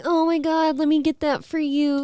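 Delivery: high, gushing mock-sympathetic voice; mock bleeding heart. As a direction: ham up a too-kind stranger. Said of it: "too much of a bleeding heart".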